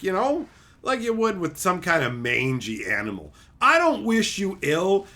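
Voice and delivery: ordinary talk, nothing marked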